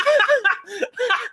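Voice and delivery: sudden high burst of real laughter. Real, not acted. Streamer reacting to a clip, bursting out laughing.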